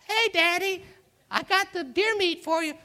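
chirpy fake voice for Daddy; mock son voice. Jacob cheerfully greeting his blind father in a high voice.

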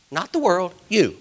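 slow, high, loud preacher's cry; preaching at full force. Acted. Pastor preaching hard to his congregation, slow and loud. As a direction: drive it home to the church.

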